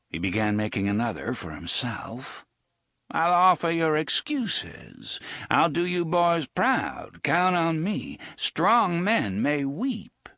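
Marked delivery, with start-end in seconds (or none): 3.1-10.3 put-on high, slow, sly old man's voice; a wry old drifter, amused; old gunman Jonas. A narrator's reading. Jonas asking Reynolds about the brats, sly and high.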